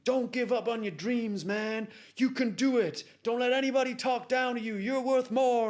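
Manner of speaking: loud, high, rousing hype; motivational speaker. Retold or imitated. Motivational speaker pumps up the trapped man, rousing shout. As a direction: imitate a speaker pumping someone up, full force. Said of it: "The motivational speaker says".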